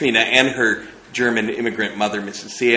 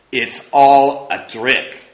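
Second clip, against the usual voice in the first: put-on slow, louder, stubborn old mother's voice; sudden conviction; 4 semitones higher, 8 dB louder, much slower than usual. Missus Sieppe dismissing the film to her daughter, convinced. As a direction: put your foot down, unconvinced. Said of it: "exclaimed Missus Sieppe with sudden conviction".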